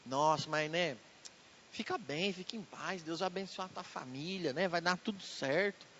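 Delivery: calm a troubled friend softly; a soft hushed reassurance; soothing comfort. Acted.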